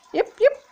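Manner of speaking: very high little dog yips; squeaky imitated bark; dog yipping. A narrator's reading. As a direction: yelp like a small dog. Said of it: "Huxley barked".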